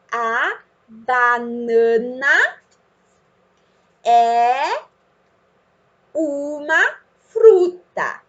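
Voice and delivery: word by word, long pauses; slow modelled reading. Shown as a demonstration. Teacher helps child read the phrase, slowly word by word. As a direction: stretch each word as for a beginning reader.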